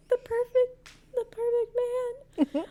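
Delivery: high, crying, swooning squeal; drawn-out lovesick squeal. Real, not acted. Swooning over fictional man.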